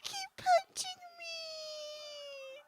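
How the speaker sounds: slow, whiny fake-sob imitation; mock crying. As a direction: wail it slowly like a hammed-up crying wrestler. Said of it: "she's, like, crying".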